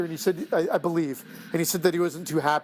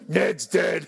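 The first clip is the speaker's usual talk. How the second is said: shout. Real, not acted. Calling out joke.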